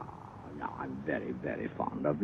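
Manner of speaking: breathy whisper falling to soft voice; hushed confession. Acted. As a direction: whisper it close and tender. Tender confession after losing temper.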